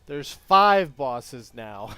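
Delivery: loud, slow, amazed outburst; excited shout. Real, not acted. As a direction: stretch it out in shock. Shocked at five bosses.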